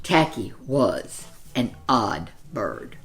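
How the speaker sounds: plain reading voice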